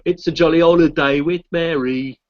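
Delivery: loud, high, drawn-out bad Cockney accent; hammed-up Cockney, slow and loud. Shown as a demonstration.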